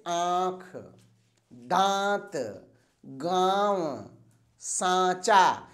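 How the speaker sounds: slow, drawn-out words with the nasal vowel stressed; teacher demonstrating nasalized vowels; nasal vowel demo. Shown as a demonstration. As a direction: say each word slowly, letting the vowel ring through the nose. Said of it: "ये शब्द जो हम बोलते हैं".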